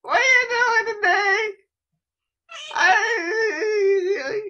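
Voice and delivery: very high, loud cartoonish voice; squealing a silly high line at his friend; squeaky put-on voice.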